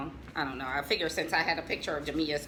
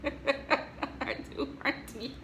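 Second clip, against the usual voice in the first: laughing softly; 7 semitones higher, 5 dB quieter, much slower than usual. Real, not acted. Laughing over child photo.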